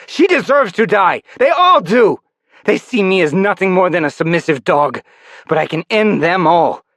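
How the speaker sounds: high, loud vengeful rant; bitter rant. Acted. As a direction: let out years of resentment.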